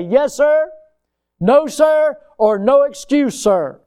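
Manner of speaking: recruit replies. Retold or imitated. Recruit answering drill sergeant.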